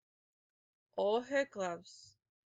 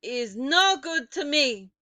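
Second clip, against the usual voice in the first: put-on loud, higher accented witch; crying out; 6 semitones higher, 11 dB louder than usual. A narrator's reading. Grand High Witch scolding the audience, loud. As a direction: snap it out impatiently. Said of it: "the Grand High Witch cried".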